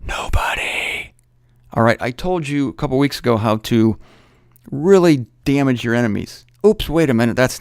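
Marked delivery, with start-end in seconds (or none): 0.1-1.1 a slow secret whisper; hushed whisper, drawn out; whispered answer. Shown as a demonstration. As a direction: breathe it like a clue. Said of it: "Let me give you a little clue".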